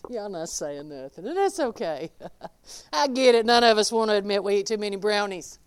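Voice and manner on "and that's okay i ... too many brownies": ordinary delivery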